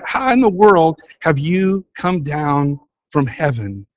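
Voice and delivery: usual speaking voice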